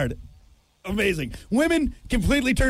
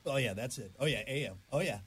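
low, very soft mock pleasure; mock arousal; 8 semitones lower, 13 dB quieter than usual. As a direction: murmur it low, as if secretly turned on.

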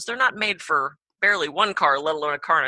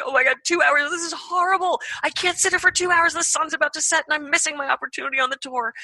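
high, frantic self-pity on the roadside; panicked whining; 11 semitones higher than usual. Retold or imitated. Stranded driver whining to herself, high and frantic. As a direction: wail it like your evening is ruined.

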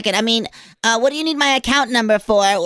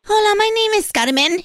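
higher, bright Hola greeting; caller character voice; 7 semitones higher than usual. Acted. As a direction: introduce yourself as a lively caller. Caller introduces herself in character.

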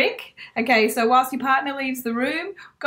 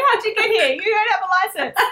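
mock police officer; 9 semitones higher, 3 dB louder, faster than usual.

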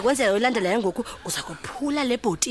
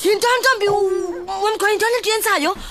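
loud, outraged scolding; angry shouting; 8 semitones higher, 3 dB louder, slower than usual. Acted. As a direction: yell at her over the spilled whiskey. Angry over spilled whiskey.

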